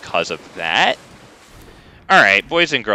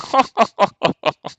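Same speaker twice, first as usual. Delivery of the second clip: high, haughty aristocrat's laugh; smug lordly chuckle; 10 semitones higher than usual. Retold or imitated. The British lord laughing at his opponent, smug chuckle. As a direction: chuckle like a lord amused by a peasant. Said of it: "that's my impression".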